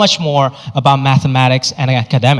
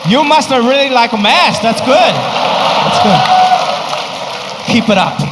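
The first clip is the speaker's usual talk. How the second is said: approving dad. Retold or imitated. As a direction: praise him like a proud strict father. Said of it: "he was like".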